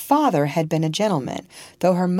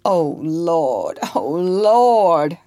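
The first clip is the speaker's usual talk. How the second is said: louder groaning plea, second one rising; groaning in pain; 4 dB louder, much slower than usual. Acted. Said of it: "groaned Tom".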